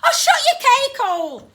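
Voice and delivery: high, loud Cockney shout; exasperated, rough-edged boy's yell. Acted. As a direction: shout them down as a rough lad. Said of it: "His accent was infinitely rougher around the edges".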